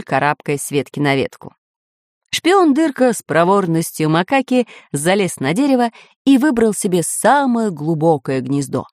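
plain reading voice

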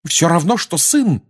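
mother fiercely defending her bond; furious retort. Acted. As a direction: snap back in fury. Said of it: "яростно возразила".

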